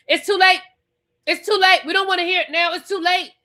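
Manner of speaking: mocking Gizelle. Recapping Gizelle refusing Angel's apology, high and loud.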